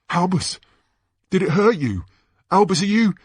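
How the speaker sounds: high, panicky questions; alarmed concern. Acted. Boy checking his hurt friend, high and frightened. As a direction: call to an injured friend.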